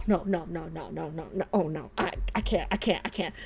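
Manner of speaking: quiet dismay. Real, not acted. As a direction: shrink away under your breath. Overwhelmed by the scene.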